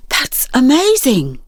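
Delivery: slow, breathless wonder; awed gasp on the words; gasped amazement. Acted. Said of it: "gasped Luke".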